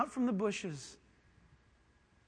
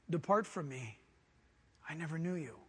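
lower, soft, grave sentence; grave verdict; 5 semitones lower, 5 dB quieter than usual. Acted. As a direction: pronounce the final judgment. Jesus' verdict, low.

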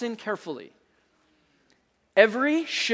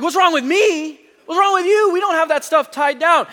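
loud, higher indignant comeback; retorting back; 6 semitones higher, 3 dB louder than usual. Retold or imitated. Driver yelling back at boss over loose chairs, indignant. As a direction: throw it right back at him, exasperated.

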